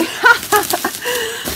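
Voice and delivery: high excited giggle; giddy laugh. Real, not acted. Opening the package, a high giddy laugh. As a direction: bubble over with a squealing laugh. Giddy over new cutter.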